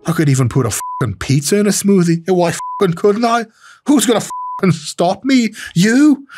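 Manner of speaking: high, escalating Irish fighter challenge; mock-belligerent Irish brogue, rising to the end; Irish accent. Host as the fighter daring the camera guy to stop him, Irish accent. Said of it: "do an Irish accent".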